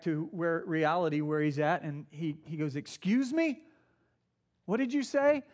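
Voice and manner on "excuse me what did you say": high, startled question, caught off guard. Retold or imitated. Rabbi startled by the soldier, high surprised question. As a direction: snap out of a daze and ask in surprise. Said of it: "it kinda startles him".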